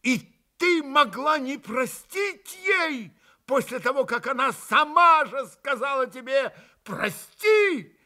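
bitterly reproaching Grushenka, high cry; bitter exclamation. A narrator's reading. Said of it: "горько воскликнул опять Митя".

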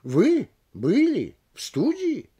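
slow, high rise on ВЫ, БЫЛИ and СТУДИИ; three separate questioning peaks, ВЫ... БЫЛИ... СТУДИИ, drawn out; question on all words. Shown as a demonstration. Lecturer demonstrating a full question, slow rise on each word. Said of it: "вопросительную интонацию сразу во всех трёх".

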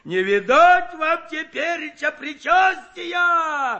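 slow, high, loud mock-terrified shout; mock horror shout. A narrator's reading. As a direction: yell it in pretend dread. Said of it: "В притворном ужасе закричал".

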